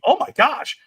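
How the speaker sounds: high-pitched mock astonishment; mimicked shock. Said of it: "but it wasn't like".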